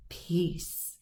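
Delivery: breathy whispered peace; hushed command. A narrator's reading. Traveler calming the storm, whispered and hushed.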